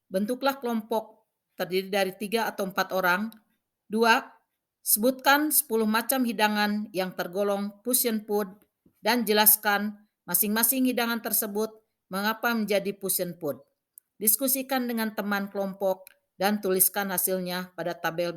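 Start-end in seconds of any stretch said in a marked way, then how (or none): none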